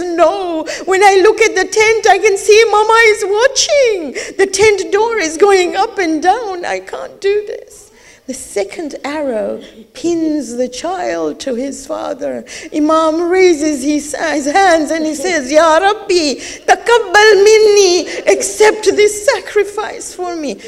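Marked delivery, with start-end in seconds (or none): 0.0-7.8 high and loud, choking on tears; refuse, overcome by sobs; tearful refusal. Acted. Archer voiced refusing in tears. 15.5-20.6 loud, high prayer broken by sobs; weeping prayer. Acted. Imam's prayer of sacrifice.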